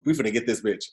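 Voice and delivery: low, soft scheming mutter; mock vengeful guys. Host voices angry fans plotting in a low scheming voice. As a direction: plot revenge under your breath. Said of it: "was like".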